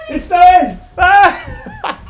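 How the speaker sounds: high delighted shout at the snow; excited shout. Real, not acted. Excited shout to viewers on seeing snow fall. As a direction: yell it out, thrilled by the snow.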